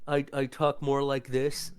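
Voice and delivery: jaw pushed forward, slightly thick words; lightly voiced underbite demo. Shown as a demonstration. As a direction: talk through an underbite. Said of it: "extend my lower jaw ... more of an underbite".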